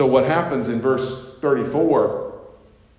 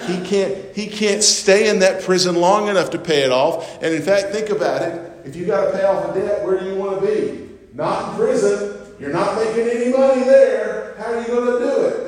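rising, much higher, louder preacher's climax; preaching at full force; 8 semitones higher, 5 dB louder than usual. Acted. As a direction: build the point and drive it home. Preaching climax on unpayable debt.

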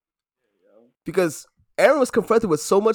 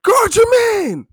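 high, loud mock shout; imitating a teammate yelling; 9 dB louder than usual. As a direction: bark the order in a squeaky voice. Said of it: "Voodoo looks at Aaron and says".

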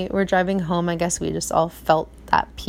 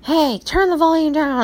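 nagging parent; 8 semitones higher, 4 dB louder than usual. Retold or imitated. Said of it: "you're like".

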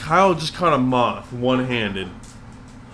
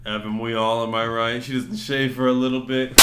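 ordinary talk

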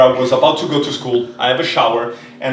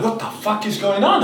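freaking out; 7 semitones higher, 3 dB quieter than usual. Retold or imitated. I'm freaking out.

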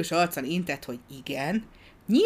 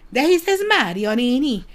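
high, loud burst of amazement; startled child's exclamation, pitched high; astonished little girl; 8 semitones higher, 9 dB louder than usual. A narrator's reading. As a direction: cry it out in astonished recognition. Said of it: "rebegte a kislány".

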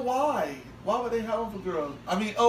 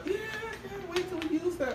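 a touch higher, softer, put-on eagerness; fake enthusiasm; 8 semitones higher, 9 dB quieter than usual. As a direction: pretend you're thrilled to please him. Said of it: "you gotta be like".

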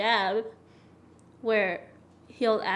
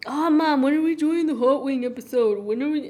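put-on whiny kid voice; 3 semitones higher, 6 dB louder than usual. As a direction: nag like an impatient kid. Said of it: "every single week he was like".

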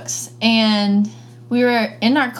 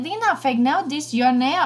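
higher imitated broken English; nail tech accent; 4 semitones higher than usual. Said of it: "She was like".

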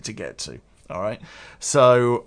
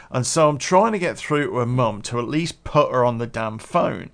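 usual speaking voice

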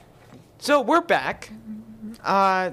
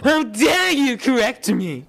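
high, loud, slow outrage; offended king; 9 semitones higher, 7 dB louder, slower than usual. Acted. King taking offense at the warlock, mock outraged. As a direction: bellow like a wounded monarch.

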